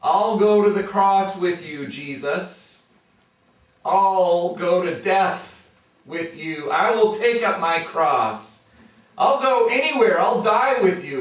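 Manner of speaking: loud, high eager promise; fervent vow. Retold or imitated. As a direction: swear it with everything, building up. Peter's boastful vow voiced.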